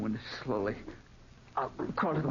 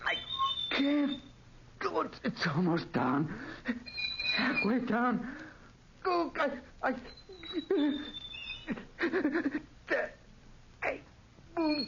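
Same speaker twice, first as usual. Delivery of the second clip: strained terrified gasps; 12 semitones higher, slower than usual. Acted. Straining to move.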